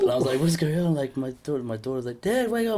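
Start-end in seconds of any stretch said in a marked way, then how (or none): 0.4-0.9 startled. Retold or imitated. Woken by daughter, startled. 2.2-2.8 put-on high, urgent young girl calling out; urgent daughter voice. Daughter waking him urgently.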